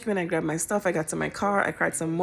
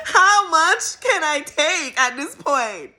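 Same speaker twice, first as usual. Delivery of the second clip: exasperated; 8 semitones higher, 4 dB louder, slower than usual. Real, not acted. Exasperated rhetorical question.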